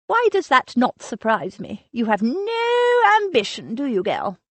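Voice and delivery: put-on imperious elderly aunt, scornful and sharp; a grand dame dismissing the girl's excuse; haughty old aunt. Aunt Agatha scolding Lily.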